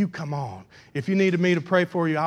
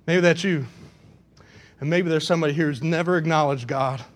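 ordinary talk, nothing marked

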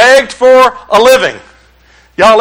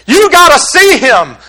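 high, pressing order; emphatic demand; 6 semitones higher, slower than usual. Acted. Religion ordering believers to see him, high and pressing. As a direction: push it hard, pitched up.

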